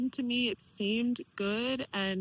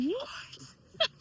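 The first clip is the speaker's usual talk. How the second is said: incredulous; 6 dB quieter than usual. Retold or imitated. Baffled by aunt's accusation.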